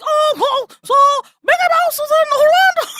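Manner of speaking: very high, laughing Mickey Mouse squeak. Retold or imitated. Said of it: "everyone sounded like Mickey Mouse".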